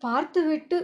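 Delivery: plain reading voice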